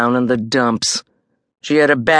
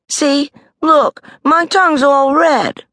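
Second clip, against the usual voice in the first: Judy insisting; 13 semitones higher, 5 dB louder than usual. A narrator's reading. Judy showing fake sick tongue.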